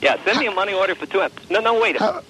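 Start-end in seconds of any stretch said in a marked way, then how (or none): none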